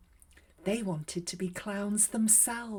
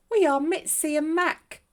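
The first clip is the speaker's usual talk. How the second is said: higher and louder, eager little mice; mouse voices; 9 semitones higher, 10 dB louder than usual. A narrator's reading. Mice introducing themselves to the clown, high, loud and eager. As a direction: squeak it out like two tiny eager mice. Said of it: "said the mice".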